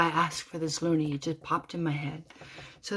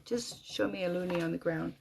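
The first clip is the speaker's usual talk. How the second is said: softer, usual pitch, dropping away; subdued plea; 7 dB quieter than usual. Retold or imitated. Widow's last request to Jeremy, hushed and resigned. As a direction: repeat the demand quietly, spent.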